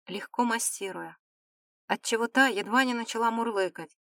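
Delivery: usual speaking voice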